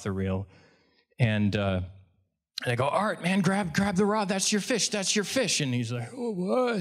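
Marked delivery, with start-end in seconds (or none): 2.9-5.6 high-pitched, excited urging; urgent coaching. Retold or imitated. Guide tells Art a fish is on, urgent and high. Guide urges client to grab rod. 6.1-6.8 high-pitched befuddled mumble; confused old guy. Retold or imitated. Art doesn't know what's going on, high confused voice. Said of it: "he's confused".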